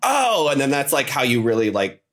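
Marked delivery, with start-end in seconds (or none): 0.0-0.5 higher-pitched "oh" of surprise; a sudden little gasp of recognition; imitated delighted recognition. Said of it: "and be like".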